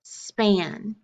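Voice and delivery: normal talking voice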